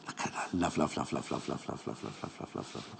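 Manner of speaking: very soft, low rapid repeats fading into whisper; hushed, fading chant of love; adoring whisper. Real, not acted. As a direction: murmur it over and over, dwindling away. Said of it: "Can you tell I love this?".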